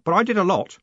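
put-on high, earnest young clerk voice; keen, nervous junior clerk; eager young clerk. A narrator's reading.

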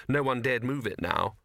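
ordinary delivery, nothing marked

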